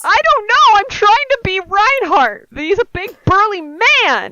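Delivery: high, loud exasperated defence; loud, high-pitched self-defence; loud protest. Real, not acted. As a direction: protest at the top of your voice. Protesting amid laughter at failed accent.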